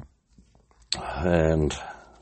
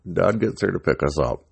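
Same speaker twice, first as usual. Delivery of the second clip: ordinary delivery, nothing marked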